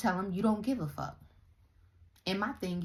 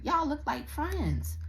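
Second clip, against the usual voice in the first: exasperated; 10 semitones higher, slower than usual. Real, not acted. Ranting at scattered family.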